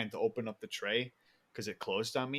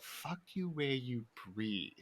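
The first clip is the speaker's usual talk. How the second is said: slow, softer puzzled repeat; Chris D'Elia; 7 dB quieter, slower than usual. Retold or imitated. Comedian repeats the guy's odd insult, slow and quiet. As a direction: echo it slowly and quietly, drawing out each word. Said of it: "Chris was like".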